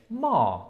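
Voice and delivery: MA drawn out with a falling tone; syllable melody. Shown as a demonstration. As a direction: say ma as one tone of a tonal language, falling. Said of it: "depending on the melody".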